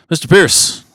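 loud, high court call of a name. Retold or imitated. Clerk calling him up to the podium, loud and high.

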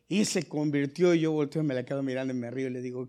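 ordinary delivery

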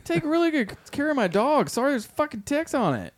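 high mocking vet voice. Imitating the condescending vet, pitched high. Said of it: "it's like".

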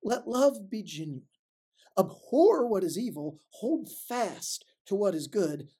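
measured, solemn Bible reading; scripture reading. Acted. Preacher reading Romans to the congregation, solemn. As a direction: read the verses with weight and pauses.

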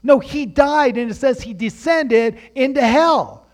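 high and loud sermon climax; fiery preacher proclaiming the resurrection; preaching at full force. Acted. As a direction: proclaim it to the whole church. Preaching climax about Jesus.

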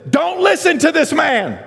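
high, slow, emphatic yell; impassioned high shout, words drawn out; re-enacted shouted rebuttal. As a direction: holler it slowly to a room of mourners. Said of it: "I said it just like this".

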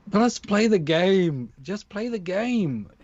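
high, drawn-out pleading; stretched-out, fed-up appeal; exasperated plea. Acted. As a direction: plead slowly, pitch swooping up then falling. Exasperated plea to fretting baseball people.

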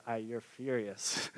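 calmly naming the real feeling; God's reply. Retold or imitated. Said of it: "And God's like".

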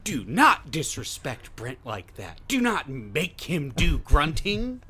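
slow, drawn-out mock telling-off; play-outraged reprimand; mock scolding. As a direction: scold the guest with exaggerated weight on each word. Mock scolding on guest's behalf.

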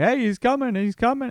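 high, loud call announcing the arrival; yelling he's coming. Retold or imitated. Family members spotting the missing dad's car, calling out loudly. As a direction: shout the news across the yard. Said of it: "people just start yelling".

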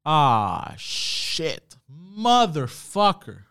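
slow-dawning realization turning into a curse; hammed-up frustrated swearing at himself; retold dismay. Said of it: "I was like".

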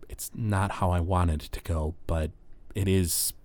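soft, understated parting words; subdued. Acted. Esther's resigned parting after the verdict.

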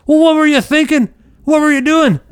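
very high, loud mock scream; retold screaming. Vice principal screaming at the teen who hit him. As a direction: blast it at the kid like an outraged principal. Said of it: "He starts screaming at me".

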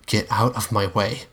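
ordinary delivery, nothing marked

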